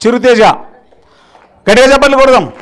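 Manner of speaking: loud, high, drawn-out call to the crowd; calling for applause. Real, not acted. Speaker asks the audience to applaud, loud drawn-out rally. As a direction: stretch out the rallying cry slowly.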